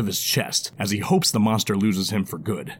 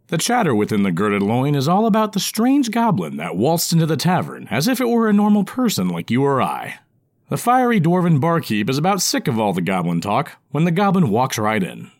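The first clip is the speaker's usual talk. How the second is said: ordinary delivery, nothing marked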